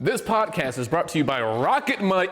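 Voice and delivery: joking podcast ad voice; mock ad read. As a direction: announce a fake sponsor with gusto.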